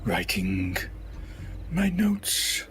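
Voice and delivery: sing-song caterer voice. Acted. Caterer startled, covering excuse.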